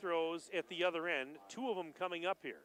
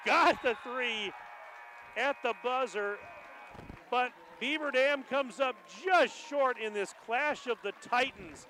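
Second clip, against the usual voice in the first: excited shout; 4 semitones higher, 5 dB louder than usual. Real, not acted. Buzzer-beater shot call.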